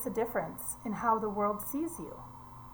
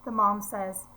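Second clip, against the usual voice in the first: normal talking voice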